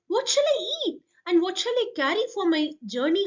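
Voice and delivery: high, pleading question; pleading sparrow. Acted. Sparrow begging the queen, high and pleading. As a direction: beg like a tiny bird.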